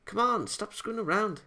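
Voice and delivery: high, impatient scold; annoyed scolding. Acted. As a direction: tell off a silly partner. Annoyed partner scolds her.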